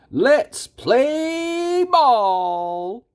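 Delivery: rally everyone like an excited ringmaster. A narrator's reading. The circus master kicks off the game, excited and drawn-out. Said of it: "adds an excited Raimundo".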